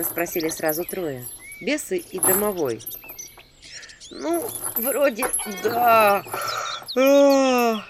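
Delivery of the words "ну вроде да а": yawning. A narrator's reading. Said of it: "зевнула я".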